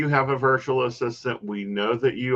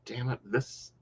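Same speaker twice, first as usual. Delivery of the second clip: muttered frustration; 2 semitones higher, 11 dB quieter than usual. Retold or imitated. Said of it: "And I'm like".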